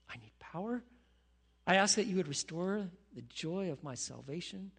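soft, quiet prayer sinking to a whisper; heartfelt prayer. Acted. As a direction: pray it quietly, almost to yourself. Leads a spoken prayer.

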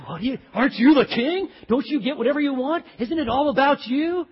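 loud, high taunting questions; mock berating. Jezebel goading her husband the king, loud mock berating. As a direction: hammer him with rising questions.

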